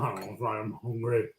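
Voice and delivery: talking through a mouthful of food; chewing while speaking; mouth full. Real, not acted. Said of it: "you keep filling your mouth".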